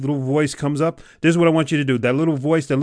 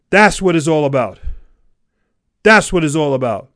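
loud, raised, punchy declaration; emphatic exhortation; 3 semitones higher, 7 dB louder than usual. Real, not acted. Coach capping the readiness chant, emphatic and loud. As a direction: land it hard like a rally's payoff. Motivational climax after the chant.

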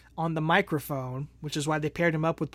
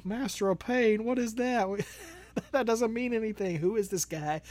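higher-pitched, incredulous imitation; Terry Funk; 5 semitones higher, 3 dB quieter than usual. Host quotes a wrestler dismissing the gimmick, mock-incredulous impersonation. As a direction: quote him in a raised, put-on voice. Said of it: "Terry Funk talking about".

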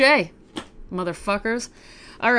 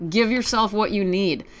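ordinary talk

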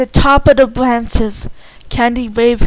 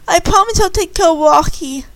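high, earnest pledge; a girl promising the raccoon she'll keep Rocky, tearful; tearful promise; 7 semitones higher than usual. A narrator's reading. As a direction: promise through mild tears. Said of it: "Candy cried".

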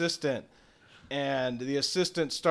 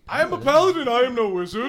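much higher and louder; indignant declaration; 10 semitones higher, 9 dB louder, faster than usual. Acted. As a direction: insist with wounded pride. Paladin offended by wizard.

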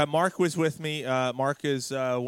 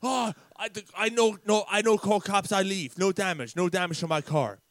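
higher-pitched sputtering broken English; flustered driver impression; 5 semitones higher than usual. Driver dodging the police after the tap, flustered impression. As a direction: play a driver backing off in bursts.

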